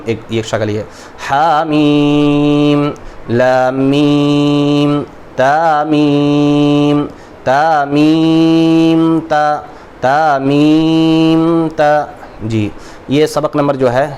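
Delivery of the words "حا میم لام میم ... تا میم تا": drawn-out, half-chanted letter names; slow chanted letter drill; slow letter recitation. Shown as a demonstration. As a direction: chant each letter slowly like a lesson drill.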